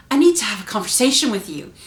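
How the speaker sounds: loud, stern demand. Shown as a demonstration. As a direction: say it loudly, like someone is in trouble. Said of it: "In the way you say it".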